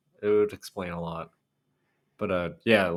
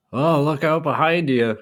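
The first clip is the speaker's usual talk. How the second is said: louder, higher warning shout; mock yell at screen; 8 semitones higher, 9 dB louder than usual. Imitating yelling at characters on TV, loud. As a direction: call out to a movie character. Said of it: "yelling at the characters".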